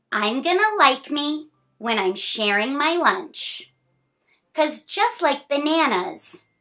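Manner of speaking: bright, sing-song picture-book reading; reading to children. A narrator's reading. Reading a picture book to a child, sing-song. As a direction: read the rhyme cheerfully to a small child.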